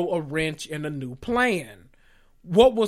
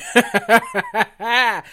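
mocking laugh; 5 semitones higher, 6 dB louder than usual. Real, not acted.

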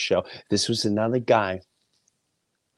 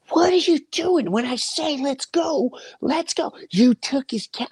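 much higher, heated mock yell; retold yelling. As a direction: fire it back at the daughter. Shouting at daughter, retold.

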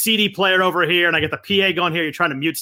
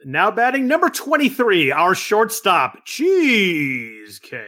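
slow booming stadium announcer; 3 semitones higher, much slower than usual. Shown as a demonstration. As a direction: stretch out the name like a ballpark PA. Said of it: "can you do that and introduce".

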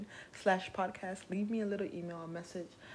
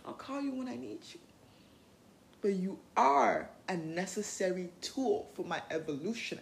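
ordinary delivery

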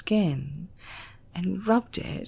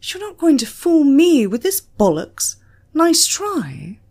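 louder, higher, mocking the threat; scornful; 9 semitones higher, 7 dB louder than usual. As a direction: sneer it off with contempt. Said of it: "He laughed scornfully".

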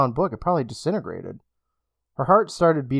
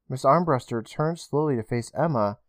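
plain reading voice

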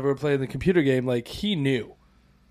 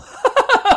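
high burst of disbelieving laughter; incredulous laugh; 5 dB louder than usual. Real, not acted. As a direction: laugh in shock. Laughing at the absurdity.

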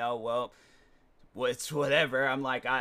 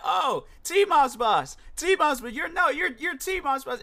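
high, slow, eager fan gushing; mock clueless fan; 11 semitones higher, 4 dB louder, slower than usual. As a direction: gush at him like a starstruck stranger.

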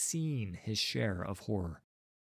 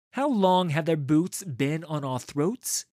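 loud, higher, indignant rallying question; rising, sharpened voice; 6 semitones higher, 8 dB louder than usual. A narrator's reading. Leader rousing the gathering against oppressors, voice rising sharply. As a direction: throw the question out to the crowd with an edge. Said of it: "His voice begins to rise, its edge sharpening".

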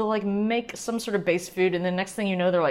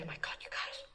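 very soft, confiding whisper; awed whisper; 15 dB quieter, faster than usual. Real, not acted. Host to listeners after sipping rosé, awed whisper. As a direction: whisper it to your listeners in awe.

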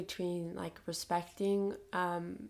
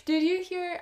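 higher, louder fangirl burst; real excitement; 8 semitones higher, 9 dB louder than usual. Real, not acted. Host reacting to guest's [name] quote, excited. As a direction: jump in high and loud, thrilled.

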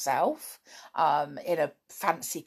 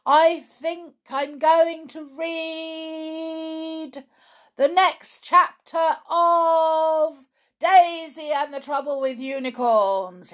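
town crier; 10 semitones higher, 6 dB louder, slower than usual. Pretend.

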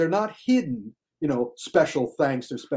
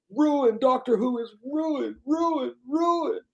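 higher and higher wail on each ruined; mock outrage, building; 10 semitones higher, slower than usual. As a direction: climb in pitch with every ruined like a tantrum. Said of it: "exclamation points, underlines, big".

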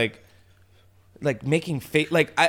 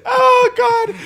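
squealing high laugh breaking through; helpless laughter; 6 dB louder than usual. Real, not acted. Laughing at herself for pulling a knife, high and shrieking. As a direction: laugh till the voice cracks. Cracking up retelling knife moment.

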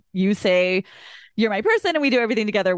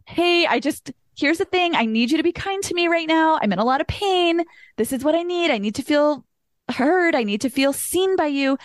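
pleading; 6 semitones higher than usual. Retold or imitated. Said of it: "after saying, you know, like".

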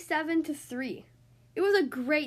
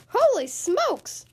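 high, slow, startled shout; startled cry; 9 semitones higher, 5 dB louder, slower than usual. Acted. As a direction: team sees the scary coach arrive, shocked cry. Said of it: "cried the team".

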